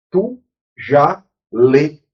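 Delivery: Tu já lê drawn out word by word, very slowly; each word of Tu já lê stretched and separated; slow, deliberate. Shown as a demonstration. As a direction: spell out Tu já lê slowly like to a class.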